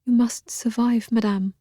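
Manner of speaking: whispered tearful plea to the sick woman; weeping whisper. A narrator's reading. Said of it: "she whispered, weeping".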